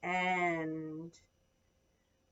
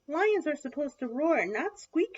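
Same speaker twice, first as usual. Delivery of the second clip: higher, louder mouse voice; scolding mouse; 6 semitones higher, 4 dB louder than usual. A narrator's reading. Mouse corrects Little Lion, high emphatic voice. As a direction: pitch it up as a small mouse. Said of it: "she said".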